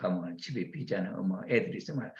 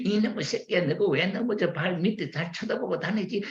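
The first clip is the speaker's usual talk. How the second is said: higher-pitched mock telling-off; scolding parent; 9 semitones higher than usual. Parent to a child who fell over, scolding.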